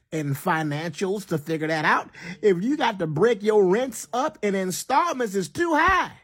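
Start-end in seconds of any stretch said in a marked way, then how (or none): 2.4-6.1 exasperated high exclaim. Real, not acted. Ranting about installment rent.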